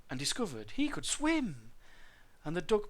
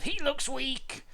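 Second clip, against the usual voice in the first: high, drawn-out grumble; disapproving mother dinosaur; grumbling; 12 semitones higher, 4 dB louder, slower than usual. A narrator's reading. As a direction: complain slowly, pitch well up. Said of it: "she grumbled".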